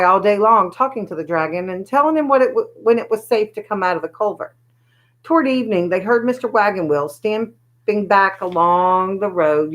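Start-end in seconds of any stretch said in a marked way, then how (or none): none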